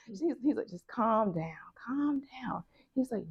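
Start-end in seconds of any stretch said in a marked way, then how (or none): 0.7-2.6 soft, hushed calming voice; retold soothing. Advisor to crying student, retold soothing. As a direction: imitate a mentor settling someone down gently.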